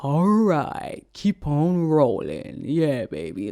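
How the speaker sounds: slow, drawn-out song intro impression; laid-back, close-to-the-mic vocal imitation. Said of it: "when [name] is like".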